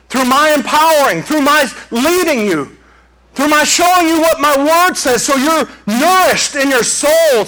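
high, fervent divine promise; voicing God. Preacher voicing God to congregation, fervent. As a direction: proclaim as God speaking. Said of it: "He says".

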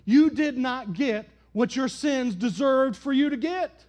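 loud, high preaching cadence; full-force preaching. Acted. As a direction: preach it out to the whole church.

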